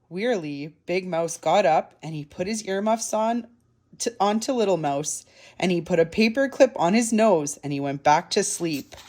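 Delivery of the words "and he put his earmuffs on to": ordinary delivery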